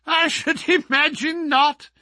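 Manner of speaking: high laugh through the words. A narrator's reading. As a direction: chuckle while speaking, mocking. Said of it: "The other laughed".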